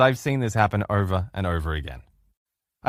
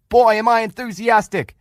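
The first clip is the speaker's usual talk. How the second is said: much higher and louder, a full-throated group yell; screaming enthusiastically; 10 semitones higher, 5 dB louder than usual. Retold or imitated. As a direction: shout it out with everything you've got. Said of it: "They were screaming".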